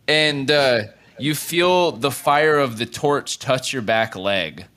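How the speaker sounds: ordinary delivery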